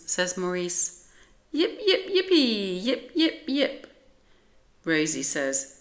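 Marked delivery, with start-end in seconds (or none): 1.5-3.9 very high, excited yaps; happy yipping. A narrator's reading. Little Fief yips happily at Maurice, very high. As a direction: yap with tiny delight.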